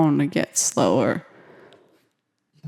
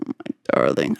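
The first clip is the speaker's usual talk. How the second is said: soft whispered much lower coo; tender coo; 3 dB quieter than usual. Real, not acted. Reading a sweet text from friend Liv, tender whisper. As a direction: coo fondly at the message.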